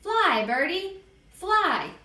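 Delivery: put-on high, gleeful small-child voice; high singsong kid calling; little kid playing bird. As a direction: pipe it up high like a playing toddler.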